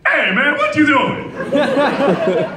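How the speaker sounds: high, laughing Eddie Murphy yell; loud Eddie Murphy. Retold or imitated. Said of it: "mostly loud Eddie Murphy".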